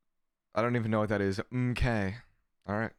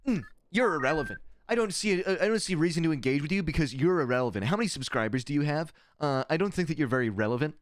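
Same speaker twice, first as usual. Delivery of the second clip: mock influencer voice; 5 semitones higher than usual. Streamer mocking how stans talk, in a mild snooty influencer voice. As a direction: mimic a snooty influencer brushing you off. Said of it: "They're like".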